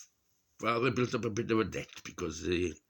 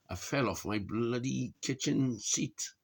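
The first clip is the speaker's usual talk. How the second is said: ordinary talk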